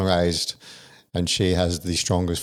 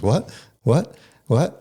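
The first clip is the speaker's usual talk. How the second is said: mimicked repeated questions, pitched up sharply; baffled listener, rising higher and louder; uncomprehending what; 5 semitones higher, 4 dB louder, much slower than usual. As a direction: act out people who keep failing to understand. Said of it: "every single conversation is".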